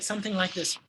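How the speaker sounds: normal talking voice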